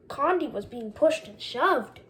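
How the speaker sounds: ordinary delivery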